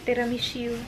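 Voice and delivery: ordinary talk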